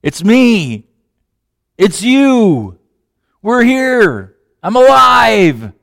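joyful reveal. Acted. Jesus joyfully revealing himself.

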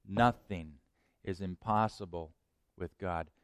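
soft, low, deliberate prayer; quiet prayer. Shown as a demonstration. Preacher teaching the congregation a prayer, soft and low. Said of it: "learn to pray this prayer".

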